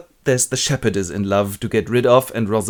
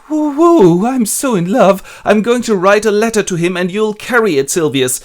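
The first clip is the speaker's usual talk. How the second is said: high, loud giddy squeal of love; lovestruck gushing; 10 semitones higher, 8 dB louder than usual. Acted. Phoebe gushing to Silvius about her crush, high giddy squeal. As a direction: gush like a teenager swooning over a crush. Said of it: "the shepherdess Phoebe goes".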